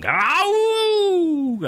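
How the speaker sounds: very high, long mock monkey howl ah ooh; cyborg monkey primal scream. Host imitates the cyborg monkey's scream as a very high mock howl. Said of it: "a primal scream of, of possibly a cyborg monkey".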